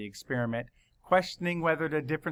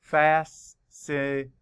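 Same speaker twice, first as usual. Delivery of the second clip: slow, each syllable counted out; 4 dB louder, slower than usual. Shown as a demonstration. Teacher counting syllables slowly for the class. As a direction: tap out every syllable slowly. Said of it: "Count the syllables".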